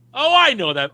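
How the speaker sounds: high, loud burst of recognition; delighted recognition. Retold or imitated. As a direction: housekeeper suddenly recognises the place. Housekeeper recognising his brogue.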